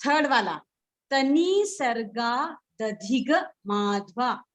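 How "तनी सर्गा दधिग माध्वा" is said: तनी सर्गा दधिग माध्वा slowly, drawn-out long vowels; slow pronunciation demo. Shown as a demonstration. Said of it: "आप pronounce करेंगे". (तनी सर्गा दधिग माध्वा का धीमा, खिंचा हुआ उच्चारण)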